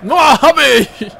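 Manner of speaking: loud, slow frustrated groan of words; frustrated outburst. Real, not acted. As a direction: burst out in annoyance. Frustrated at missed shot.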